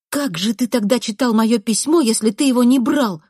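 angry interrogation. A narrator's reading. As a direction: press him for an answer. Narrator interrogating boy. (сердитый допрос)

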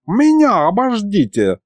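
put-on high, drawn-out old man's protest; slow, high-pitched indignation; indignant grandfather. A narrator's reading. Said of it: "возмутился дед".

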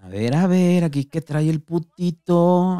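sing-song it to humiliate him; mocking taunt. The patas frisking Dani, slow mocking taunt.

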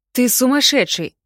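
sudden high loud outburst; blurted out. A narrator's reading. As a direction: blurt it out, startled awake. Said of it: "выпалил Нуб".

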